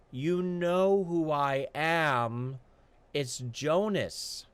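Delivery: very slow, weary, plain answer; patient Jonas. Acted. Jonas answering the guard, very slow and patient. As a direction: spell it out with strained patience.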